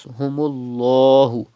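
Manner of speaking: Fazadahumullahu with a thick, full lam in Allah; heavy lam of Allah. Shown as a demonstration. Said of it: "You have to read with thicker voice".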